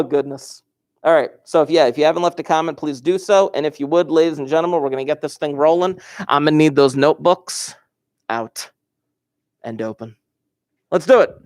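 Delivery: ordinary delivery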